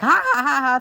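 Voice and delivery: high pretend cackle; mock gleeful laugh. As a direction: laugh it out high, like a kid pleased with a prank. Acts out laughing after the prank.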